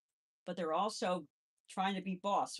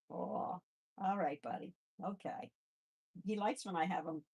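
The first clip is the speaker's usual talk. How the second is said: normal talking voice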